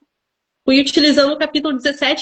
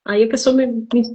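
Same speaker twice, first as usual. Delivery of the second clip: ordinary talk, nothing marked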